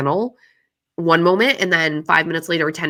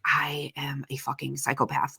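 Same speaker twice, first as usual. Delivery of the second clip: hushed self-talk; 10 dB quieter than usual. Retold or imitated.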